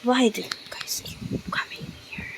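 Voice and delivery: breathy whisper to herself. Real, not acted. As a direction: whisper it under your breath. Talking to mobs under breath.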